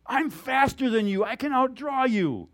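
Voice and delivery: high, loud, drawn-out boast; boastful challenger. Retold or imitated. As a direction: goad him into a fight. Young gunfighter goads veteran in story.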